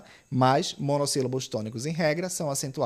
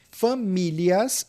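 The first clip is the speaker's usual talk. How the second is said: Famílias drawn out slowly, leaning on the mí syllable; stressed syllable shown; 5 semitones higher, much slower than usual. Shown as a demonstration. Teacher marking the stress of Famílias for students, slowly drawn out. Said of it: "paroxítona".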